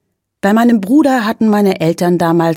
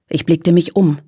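ordinary delivery, nothing marked

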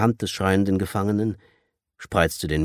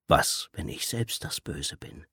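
slow, softer whisper; whispered thought; 3 semitones lower, 6 dB quieter, slower than usual. Acted. Julia secretly asks herself, whispered and slow. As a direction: breathe the dark question to yourself.

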